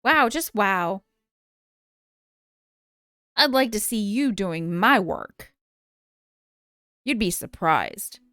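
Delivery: assassin voice. Acted. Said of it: "I'll do the assassin voice".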